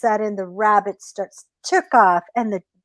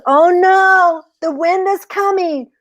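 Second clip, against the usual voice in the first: mock alarm; 10 semitones higher, 4 dB louder than usual. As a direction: cry out as if danger is coming. Rabbit fakes alarm at wind.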